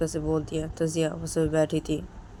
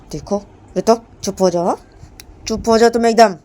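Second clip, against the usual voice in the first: louder, commanding; stern hush; 5 semitones higher, 11 dB louder than usual. Acted. Jiya ordering Ritu to shut up, louder stern.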